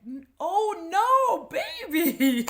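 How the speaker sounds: put-on high, loud, drawn-out oh no baby; mock dismay. Telling the character to leave him, loud mock dismay. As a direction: wail it like a dismayed friend. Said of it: "a bit of you that's just like".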